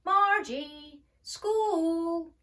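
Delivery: loud, high call across the house. A narrator's reading. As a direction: call her daughter from another room. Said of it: "Margie's mother called".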